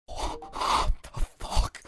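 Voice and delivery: stunned whisper. Retold or imitated. Stunned reaction to the stranger's lyrics.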